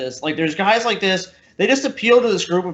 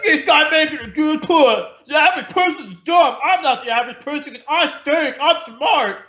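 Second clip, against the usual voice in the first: mock dumb fan; 8 semitones higher than usual. Host imitating the guy's fans, high mock fan. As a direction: play the gullible fan, pitched up. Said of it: "he's the kind of guy who's like".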